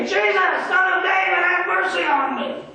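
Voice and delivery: high, loud cry of a desperate blind beggar; a blind beggar cries out to Jesus, screaming. Acted. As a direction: yell it out to be heard over the crowd. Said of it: "he's screaming".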